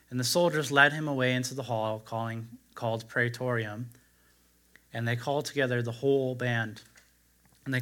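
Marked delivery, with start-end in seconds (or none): none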